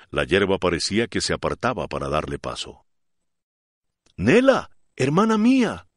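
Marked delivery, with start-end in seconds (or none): 4.2-5.9 much higher and louder, calling after her in anguish; anguished cry. A narrator's reading. Florentina calls after the fleeing Nela in an anguished cry. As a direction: cry her name as she runs off. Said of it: "gritó con angustia Florentina".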